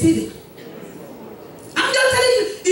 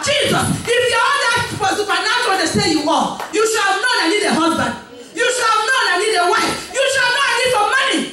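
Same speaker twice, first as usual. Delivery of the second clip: higher, fast, pushy demands; demanding crowd; 4 semitones higher, faster than usual. Acted. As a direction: rattle off entitled demands, quickly. Crowd voiced demanding of Jesus.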